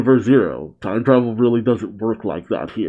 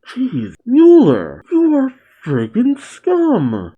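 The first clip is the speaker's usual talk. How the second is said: disgusted; 13 semitones higher, 4 dB louder, slower than usual. Real, not acted. Reacts to villain's plan.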